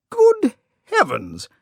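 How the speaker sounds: high, startled exclamation; surprised cry. Acted. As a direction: cry out in sudden dismay. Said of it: "he cried".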